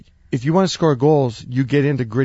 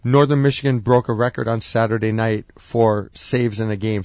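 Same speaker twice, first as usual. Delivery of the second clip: usual speaking voice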